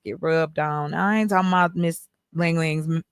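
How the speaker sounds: ordinary delivery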